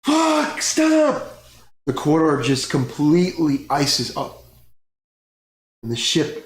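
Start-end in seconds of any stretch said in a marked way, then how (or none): 0.0-1.3 high, loud desperate yell; panicked yell. Acted. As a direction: scream it as the ship breaks apart. Dragon breaks the ship.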